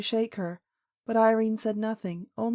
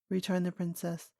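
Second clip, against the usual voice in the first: plain reading voice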